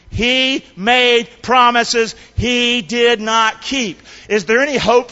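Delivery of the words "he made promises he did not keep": slow, word-by-word emphasis; leading a recitation. Shown as a demonstration. Preacher has congregation repeat the line, slow emphatic recitation. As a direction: space out each word for others to join. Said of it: "say that with me".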